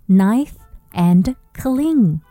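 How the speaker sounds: slow, gentle story voice; slow and coaxing. Acted. Slow repeat.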